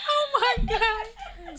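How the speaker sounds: high, slow, drawn-out laughing disbelief; helpless laughing "oh my God". Real, not acted. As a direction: stretch it out while cracking up. Host reacts, laughing hard.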